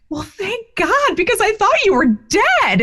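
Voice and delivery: relieved outburst. Retold or imitated. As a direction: let the relief burst out, way up high. Mother hears son is alive.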